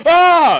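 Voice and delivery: high, loud mock shriek topping the last; mock scream. Stranger screams back at kid, hammed-up. Imagined mutual screaming scene.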